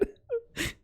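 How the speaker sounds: high wheezy laugh sound; wheezing cry-laugh. Real, not acted. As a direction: let out a crying laugh. Laugh after price shock.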